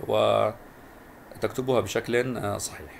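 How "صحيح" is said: usual speaking voice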